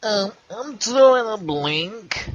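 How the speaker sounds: high, slow cartoon voice; cartoon character voice. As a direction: stretch it out like a goofy cartoon. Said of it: "You sound like a cartoon character".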